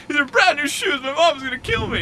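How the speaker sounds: high-pitched mock wail; crying kid. Kid upset over tied new shoes, imitated high wail. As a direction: whine it high and loud like an upset child. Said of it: "the kid was crying".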